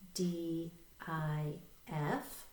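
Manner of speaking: slow, letter-by-letter spelling; spelling aloud. Shown as a demonstration. Teacher leading learners in read, spell, read, spelling aloud. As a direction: spell it out slowly, a gap after each letter. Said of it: "and they'll spell that".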